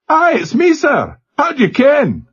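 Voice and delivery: broad Glasgow lad on a crackly line; Glasgow accent. A narrator's reading. Glasgow boy answering the captain over the speaker, Glasgow accent. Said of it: "slurred Glasgow accent".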